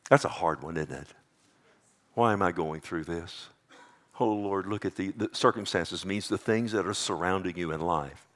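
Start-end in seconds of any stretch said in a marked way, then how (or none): none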